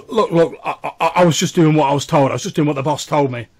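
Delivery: loud, hurried nervous excuses; flustered common man pleading; scared stammering. Acted. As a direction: stammer out a frightened defence. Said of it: "he's, like, stammering".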